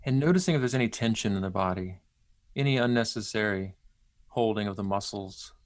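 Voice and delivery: unhurried and gentle, leave long pauses; centering exercise. Acted. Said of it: "lead you through a, a brief centering exercise".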